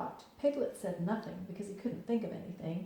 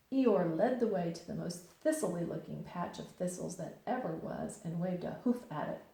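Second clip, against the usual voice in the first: plain reading voice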